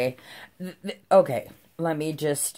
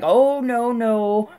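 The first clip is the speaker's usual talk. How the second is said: flustered cop; 10 semitones higher, 8 dB louder, slower than usual. Retold or imitated. Cop backing down, voiced.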